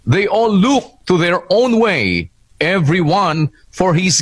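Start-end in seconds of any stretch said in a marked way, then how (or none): none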